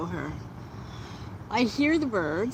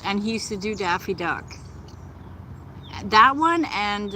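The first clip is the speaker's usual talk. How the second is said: ordinary delivery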